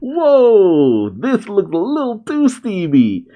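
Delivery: a mock shocked reaction to the bath scene; startled whoa. Real, not acted. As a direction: react like catching something scandalous. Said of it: "I'm like".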